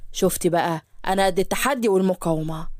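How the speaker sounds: low, smug whispered boast; a cocky groom showing off; boastful Yusuf. Acted.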